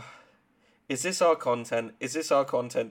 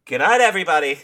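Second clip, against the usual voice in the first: loud sign-off; 6 semitones higher, 12 dB louder, faster than usual. Acted. Mock sign-off.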